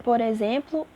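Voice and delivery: normal talking voice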